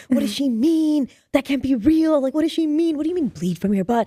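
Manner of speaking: high-pitched retold worry spiral; mock panicking. Sensitive child at home overthinking the rumour, high mock panic. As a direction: fret through it high, question piling on question. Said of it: "So I'm, like, panicking".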